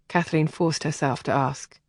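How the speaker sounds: usual speaking voice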